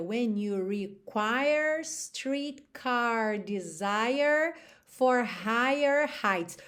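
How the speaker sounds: slow line with American R after every vowel; slow American-R lyric; American accent. Shown as a demonstration. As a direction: sing with curled American Rs, slowly. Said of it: "no americano a gente teria".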